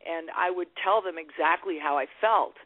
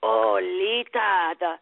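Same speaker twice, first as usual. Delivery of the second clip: put-on slow, higher, heavy Italian accent; mother's Italian accent; 3 semitones higher than usual. Lita imitating her Italian mother praising her guitar, thick Italian accent. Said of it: "heavy-duty Italian accent".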